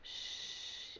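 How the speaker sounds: a long breathy "sh-" alone; whispered sh sound. Shown as a demonstration. Teacher giving the first sound of the word. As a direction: hiss out just the sh. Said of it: "It says".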